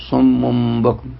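صم بكم slowed, the n turned to m before b with a long nasal hum; iqlab demo. Shown as a demonstration. Teacher demonstrating conversion to m, slow and nasal. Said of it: "بقلب النون".

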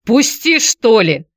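high, loud irritated snap. A narrator's reading. Ex-wife to Vladislav blocking the door, irritated high snap. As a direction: snap at him, annoyed. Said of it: "раздражённо махнула рукой бывшая жена".

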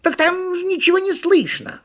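very high, loud squeal; squeaky monkey outrage; indignant monkey. A narrator's reading. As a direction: protest shrilly as a cartoon monkey. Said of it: "возмутилась «Почешись»".

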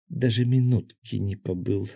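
plain reading voice

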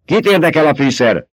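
angry retort from the duke; snapping. A narrator's reading. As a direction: cut him off impatiently. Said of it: "csattant fel a herceg".

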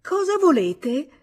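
high, loud and slow frightened woman; a woman straining to disguise her voice; alarmed, disguised female voice; disguised woman's voice. A narrator's reading. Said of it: "La voce riprese".

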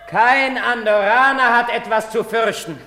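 high, loud announcer voice; mock proclamation. Andri mimics the proclamation, mock announcer. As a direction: ape the official loudspeaker.

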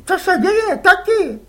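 put-on a boy asking innocently; curious boy. Les asks his father whose squid they are, boyish.

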